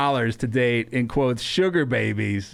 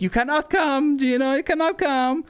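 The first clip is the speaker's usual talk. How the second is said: high-pitched mock female voice; imitating a bossy woman; 12 semitones higher than usual. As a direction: put on a high voice laying down the law. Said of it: "She's like".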